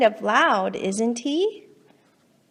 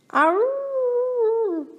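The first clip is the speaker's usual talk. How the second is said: high, long howling dog cry; a big dog howling over the fence; 12 semitones higher than usual. Acted. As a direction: howl like a lonely dog. Dog howls next door.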